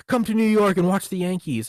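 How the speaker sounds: high, loud mock politician; LBJ. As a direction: holler a hammed-up invitation to strangers. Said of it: "He'd be like".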